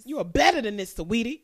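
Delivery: loud, emphatic telling-off; mock scolding. Host addressing the rapper, mock scolding. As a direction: come down hard on BETTER.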